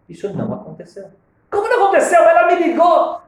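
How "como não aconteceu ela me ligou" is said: much higher and louder protest; loud high indignant protest; indignant disbelief. Retold or imitated. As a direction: protest that it really happened. Disbelieving reply to the rabbi.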